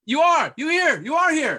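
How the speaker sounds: loud, high, swooping delight; high-pitched happy surprise; excited greeting. Real, not acted. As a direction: exclaim it as you spot a missing guest. Delighted to find missing guest present.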